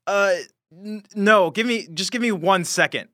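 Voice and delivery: flustered Hans. Acted. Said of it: "He's like".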